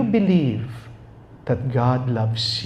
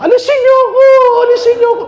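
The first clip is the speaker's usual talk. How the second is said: high, loud frantic yell; panicked shout; 10 dB louder, much faster than usual. Retold or imitated. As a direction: scream to be pulled out of the grave. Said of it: "Narinig mo yung tao".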